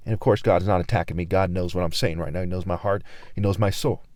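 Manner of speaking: quiet aside. Real, not acted. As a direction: drop to a hushed reassurance. Sudden low aside.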